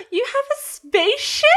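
put-on high, stunned kid question; a boy awed by a spaceship; amazed kid gasp.

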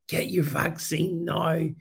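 put-on slow creepy whispery old man; creepy Joe Biden voice. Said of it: "he says in his creepy voice".

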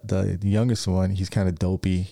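ordinary talk, nothing marked

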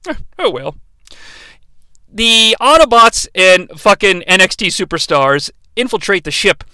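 ordinary delivery

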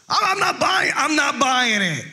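high, comic protest; hammed-up doubter, voice pitched right up; mock disbelief. Friend refusing to believe life is good, high and mock-incredulous. Acted disbelief at glum answer.

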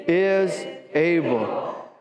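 drawn out slowly, leaning on is; slow it right down; slow emphatic. Shown as a demonstration. Pastor asking for it again, slow and emphatic. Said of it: "I want to hear it again".

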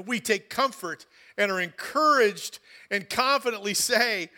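loud and very slow, each phrase set apart; declaiming scripture. Acted. Pastor reading Hebrews to the church, loud and deliberate. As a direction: proclaim it slowly like a vow. Reads the verse slowly and strongly.

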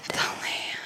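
very soft, drawn-out whisper; ASMR whisper. Pretend. Host echoing his own sponsor tagline, whispered. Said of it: "doing an A S M R channel".

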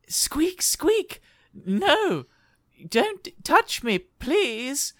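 very high, pleading little rat; squeaky rat. Acted. GM voicing the cornered lady rat pleading with the snake. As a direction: squeak out in a tiny terrified voice. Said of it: "the little rat is going".

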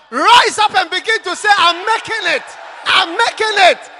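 loud, rousing preacher's shout; full-voiced call to climb every mountain; fiery preaching. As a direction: preach it to the back row.

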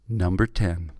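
low announcer countdown voice; top ten countdown. Acted. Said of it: "doing the countdown voice".

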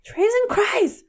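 high, exasperated mock curse; mock angry exclamation. Teasing the mispronunciation as an angry outburst. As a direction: blurt it like a frustrated driver. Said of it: "what you say when you're angry".

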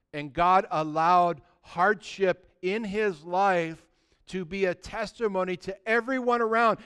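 usual speaking voice

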